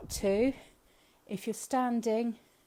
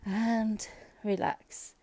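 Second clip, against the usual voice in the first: calm; 2 semitones higher, 4 dB louder, much slower than usual. Shown as a demonstration.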